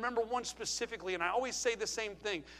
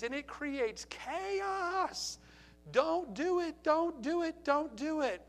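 higher, drawn-out pleading warning; urgent plea; 7 semitones higher, much slower than usual. Real, not acted. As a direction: plead with them to stop.

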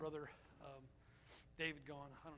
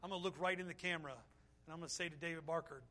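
usual speaking voice

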